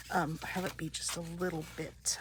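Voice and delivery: ordinary talk, nothing marked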